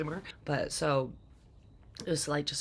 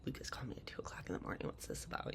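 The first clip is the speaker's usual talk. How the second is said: fast, hushed, softer; puzzled whisper; 6 semitones lower, 10 dB quieter, faster than usual. Retold or imitated. Woken at two by her brother, quick confused whisper. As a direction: whisper it fast like groggy alarm at night. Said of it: "I was like".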